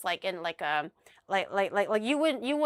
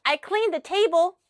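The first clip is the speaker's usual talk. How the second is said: high, louder mock-proud report; proud announcement; 7 semitones higher, 5 dB louder than usual. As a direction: imitate him showing off a chore. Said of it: "he was really proud of himself".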